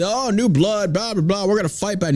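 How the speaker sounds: high-pitched dismissive mimicry; mock rallying cry; mocking politicians. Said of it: "Republicans are saying this".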